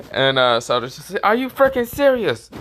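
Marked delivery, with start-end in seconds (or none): 1.2-2.5 high, loud outraged squeal; exasperated disbelief. Retold or imitated. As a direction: yelp it in disbelief. Satoru exasperated.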